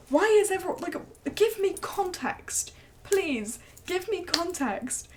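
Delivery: fed-up appeal, pitched high; exasperated plea. Retold or imitated. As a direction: plead in mock desperation, stretching the words. Acted exasperated plea to coworker.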